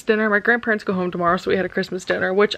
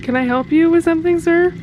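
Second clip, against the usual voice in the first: mock stern; 7 semitones higher, 4 dB louder than usual. Real, not acted. Mock-scolding the nibbling goat.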